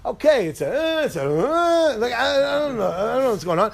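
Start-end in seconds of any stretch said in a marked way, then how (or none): 0.7-2.0 high swooping siren wail; imitated siren. Speaker demonstrating the siren's up and down thing, mimicked wail. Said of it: "the up and down thing".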